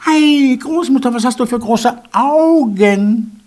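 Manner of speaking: put-on high little girl voice. A narrator's reading. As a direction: ask like a curious small girl. Girl questions the wolf.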